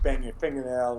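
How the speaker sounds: ordinary talk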